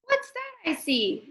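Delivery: playful question. Acted. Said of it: "Mister Dennis asked".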